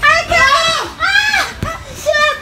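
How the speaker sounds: real panicked screaming. Real, not acted. Screaming on the ride. (patiling takot na takot)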